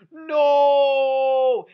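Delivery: a sudden loud, anguished yell of no; screaming no. Retold or imitated. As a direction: belt out the no like a shocked child. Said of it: "he goes".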